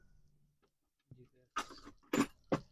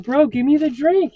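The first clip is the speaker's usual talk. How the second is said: retold shout; 12 semitones higher, 11 dB louder than usual. Retold drive-thru argument with worker.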